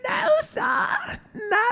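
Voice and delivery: high wailing little elf refusal; mock crying elf. Host voicing a crying elf refusing the cashier, high wail. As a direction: wail it like a pitiful tiny elf.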